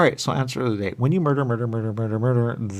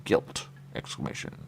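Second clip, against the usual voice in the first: ordinary talk, nothing marked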